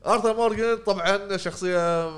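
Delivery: measured broadcast tone; news anchor read. Pretend. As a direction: announce it like the nine o'clock news. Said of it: "هذه نشرة التاسعة".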